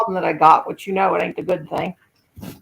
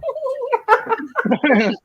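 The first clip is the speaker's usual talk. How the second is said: put-on high girly giggle; 14 semitones higher than usual. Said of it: "like a little girl".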